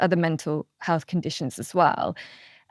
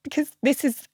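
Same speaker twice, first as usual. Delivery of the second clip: getting emotional. Real, not acted. Guest tearing up recalling her brother. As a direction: voice wavering, close to tears. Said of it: "I'm getting emotional".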